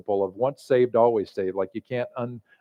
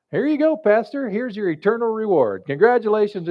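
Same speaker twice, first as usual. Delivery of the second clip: bright, higher, chirpy Saint Peter; cheerful Saint Peter; 7 semitones higher than usual. Acted. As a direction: hand over the prize with a cheery lift. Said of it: "his clipboard and says".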